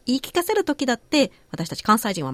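usual speaking voice

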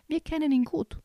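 high, lightly voiced elf call. A narrator's reading. Plant elves answering the forest folk, calling out. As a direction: call it out like an eager little elf. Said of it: "riefen die Pflanzenelfen".

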